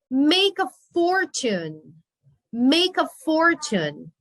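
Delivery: slow and drawn out; slow model reading. Shown as a demonstration. Teacher models the phrase slowly for the students. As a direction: introduce Make a fortune slowly.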